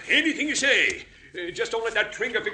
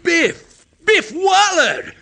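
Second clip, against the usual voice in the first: high, loud, drawn-out surprise; astonished recognition; 8 semitones higher, 7 dB louder, much slower than usual. Acted. Wagon driver recognizing his escaped old pal, astonished. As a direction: exclaim the name in amazement.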